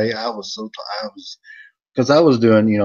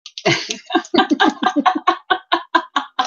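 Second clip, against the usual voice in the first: big laugh; 4 dB louder than usual. Real, not acted. Laughing after his Vern bit.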